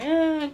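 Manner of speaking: a high whimpering "eh"; mock toddler whimper; imitated crying whine. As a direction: mimic the son starting to cry.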